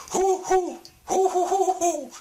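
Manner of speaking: high laughing hoo hoo; owl hoots. Acted. Doctor Owl joining the donkey's song with high hoots. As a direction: hoot high like a merry owl. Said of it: "said Doctor Owl".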